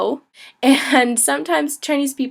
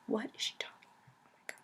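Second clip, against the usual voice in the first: whisper; 23 dB quieter, much faster than usual. Retold or imitated. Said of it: "people were like".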